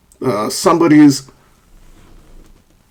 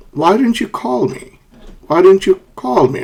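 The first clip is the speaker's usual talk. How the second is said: high "why didn't you", then "me" falls; falling question; 6 semitones higher than usual. Shown as a demonstration. Teacher models a wh-question, falling intonation. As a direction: start high, drop on "call me". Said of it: "Do you notice a falling intonation at the end".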